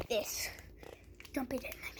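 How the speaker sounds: unvoiced whisper. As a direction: hush it like a secret. Playful secret aside.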